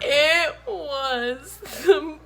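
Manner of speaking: slow, high laugh-cry. Real, not acted. Wife laughing at husband's chase story, slow and high. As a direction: squeeze words out through laughter.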